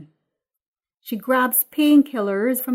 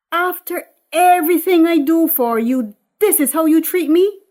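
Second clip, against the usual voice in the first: hurt mother, loud and higher; Eunice; 8 semitones higher, 10 dB louder than usual. Acted. Eunice to Patrice, loud hurt reproach. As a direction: pile on the guilt.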